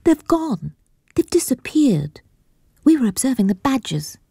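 put-on high, girlish and disappointed; a young lady letting out a sad sigh. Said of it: "sighs the girl".

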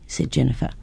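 usual speaking voice

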